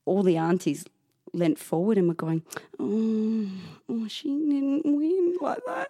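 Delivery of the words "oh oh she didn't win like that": high, drawn-out sympathetic coo; mock pity. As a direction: mimic pitying aunties, slowly. Aunties' pitying reaction acted out.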